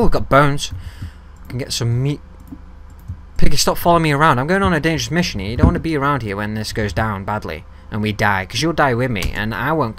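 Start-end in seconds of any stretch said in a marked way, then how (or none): 3.4-6.7 urgent scolding. Real, not acted. Scolding pet pig in game.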